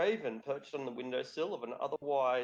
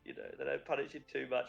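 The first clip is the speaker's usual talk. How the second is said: ordinary talk, nothing marked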